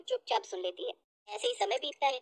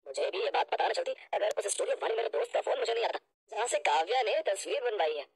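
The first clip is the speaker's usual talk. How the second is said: stern husband; 4 semitones lower, faster than usual. Acted. Husband telling his mother the truth, stern and low. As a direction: lower male-role voice. Voices Pranjal defending his wife.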